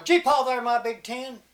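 loud, high holler to a horse team. Acted. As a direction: holler it out proudly. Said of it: "He'd holler".

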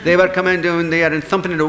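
ordinary delivery

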